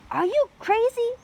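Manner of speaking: very high, very loud yell; shouted call. A narrator's reading. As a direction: yell after her in alarm. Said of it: "Jack called".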